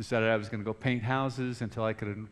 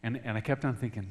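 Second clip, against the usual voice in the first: ordinary talk, nothing marked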